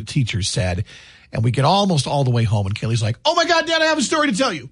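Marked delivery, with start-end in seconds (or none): 3.2-4.7 high, gushing imitation of an eager kid; bursting with news, pitched way up; excited daughter. Daughter bursting to tell Dad a story, excited. Acting daughter's urgent announcement in car.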